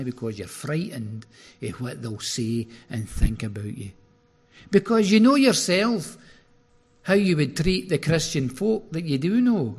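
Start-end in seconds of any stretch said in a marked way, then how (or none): 4.7-9.7 fervent preaching. Acted. Preaching peak.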